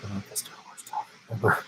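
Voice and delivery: breathy near-silent whisper; whispering a private dislike; whispered aside. Real, not acted. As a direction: say it under your breath like a confession. Reading comment aside.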